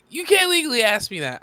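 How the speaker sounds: high, loud indignant protest; mock outrage. Player repeats legal objection, mock outrage.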